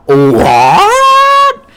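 a very high, loud, cartoonish shocked "What?"; baffled squeak. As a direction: shriek it like a stunned cartoon cop. Gordon loses burger, baffled.

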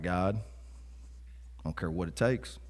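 low, hushed, slow plea to God; desperate prayer. Retold or imitated. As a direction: pray it under your breath.

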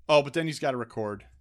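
ordinary talk, nothing marked

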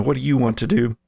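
ordinary talk

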